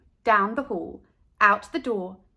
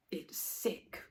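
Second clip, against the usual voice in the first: breathy whisper, much softer; 5 semitones lower, 14 dB quieter than usual. Acted. Boy muttering his verdict on Carla's lunch in a whisper. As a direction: drop to a dismissive whisper. Kid's dismissive last word.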